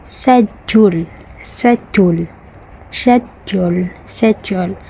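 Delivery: Indian "schedule" without the k, like "shed-yool"; Indian accent. Shown as a demonstration. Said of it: "For the Indian".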